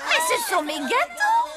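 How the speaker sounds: nettement plus aigu, avec un rire dans la voix; s'exclame, toute fière et amusée; fierté ravie. Acted. Baker proud of her cakes. (la cuisinière, ravie, revendique ses gâteaux en riant)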